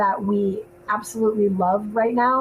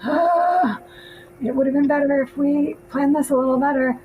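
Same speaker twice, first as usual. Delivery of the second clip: regretful sigh; 5 semitones higher than usual. Retold or imitated. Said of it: "we were like".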